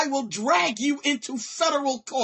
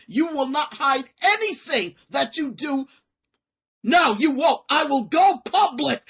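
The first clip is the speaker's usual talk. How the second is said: much louder, hammered out; loud threat; 3 semitones higher, 7 dB louder than usual. Real, not acted. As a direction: bang out the threat. Threatening the group.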